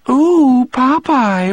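very high cartoon coo; Olive Oyl. A narrator's reading. Olive in the cartoon book to Popeye, high cartoon voice. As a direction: squeal it like a cartoon sweetheart. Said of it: "read the balloon over Olive's head".

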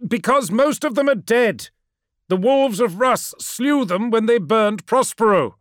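high, loud, urgent truth-telling; desperate and emphatic; anguished insistence. Acted. Ammon confronts Magnus with truth.

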